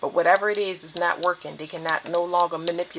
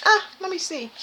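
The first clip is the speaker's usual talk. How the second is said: high-pitched, offhand musing voice; higher, acted-out curious aside; casual curiosity; 11 semitones higher than usual. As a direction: toss it out high, like idly giving it a try. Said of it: "you just like".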